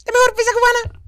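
high squeaky voice. Acted. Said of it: "Esa, esa voz está buena".

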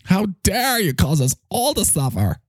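lightly voiced outrage; Greta Thunberg voice. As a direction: scold everyone like a parody activist. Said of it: "did you hear Greta?".

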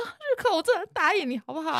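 high, small mock-crying voice; Monica's tearful pleading. Imitating Monica pleading with an angry coworker, mock tearful. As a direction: imitate someone promising through tears. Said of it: "第一次看到他大哭".

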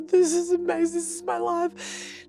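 high-pitched, overwhelmed whimper of gratitude; hammed-up tearful squeak, fading and slowing at the end; mock grateful crying. Retelling her sunlight moment of gratitude, mock crying. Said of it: "I'm just, like, crying in the sunlight".

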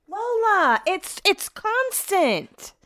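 high, stretched-out exasperated whine. Real, not acted. Owner fed up with Lola, exasperated whine. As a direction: let the complaint draw out high.

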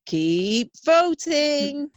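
very slow, stretched out "Keep voting."; hammed-up slow "Keep voting." plea; drawn-out plea. Shown as a demonstration. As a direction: drag out "Keep voting." slowly. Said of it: "repeated plea".

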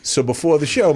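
ordinary talk

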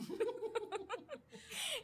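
high run of laughter; real high-pitched giggling; amused laugh. Real, not acted. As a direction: laugh at your own remembered scare. Laughs recalling the scare.

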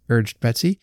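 plain reading voice

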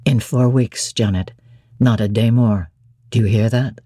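deep, low, doting voice; Rochester, fond. A narrator's reading. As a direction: croon it low to his bride.

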